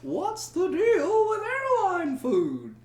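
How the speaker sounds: high, slow stand-up delivery; drawn-out observational comic voice; Jerry Seinfeld impression. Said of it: "okay, Jerry Seinfeld".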